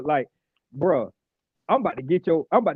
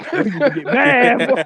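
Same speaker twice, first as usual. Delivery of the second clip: laughing; 6 semitones higher, 8 dB louder than usual. Real, not acted. Laughing while retelling prank.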